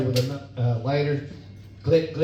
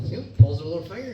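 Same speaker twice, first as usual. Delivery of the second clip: ordinary talk, nothing marked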